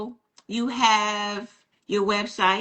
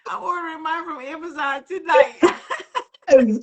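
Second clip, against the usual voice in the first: words broken by laughter, slowed; laughing; 6 semitones higher, 3 dB louder than usual. Real, not acted. Host cracking up at the taser story, laughing while talking. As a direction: laugh through the line.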